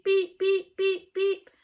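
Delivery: high, loud mock beeps; fax machine beeps. Narrator mimicking a fax machine, high and loud.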